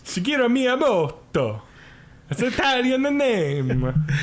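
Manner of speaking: mock Italian accent. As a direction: ham up a fake Italian accent. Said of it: "That's my Italian accent".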